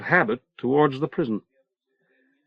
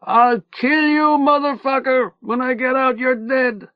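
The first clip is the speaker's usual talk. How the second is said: howling threat; 13 semitones higher than usual. A narrator's reading. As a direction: howl the threat over the others. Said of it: "a voice howling over the others".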